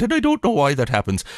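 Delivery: Irish accent. Shown as a demonstration. Host showing guest how his accent slips, Irish accent. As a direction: slip into a mock Irish accent. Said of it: "quickly devolves into my Irish accent".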